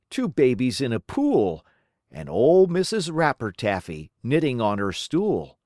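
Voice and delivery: ordinary delivery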